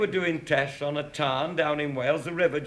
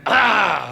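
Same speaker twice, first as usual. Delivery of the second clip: tiger roar; 3 semitones higher, 9 dB louder than usual. Retold or imitated. Tiger springs in the story.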